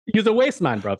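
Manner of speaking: high mock London slang jab; London roadman accent. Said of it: "I'm on Top Boy right now".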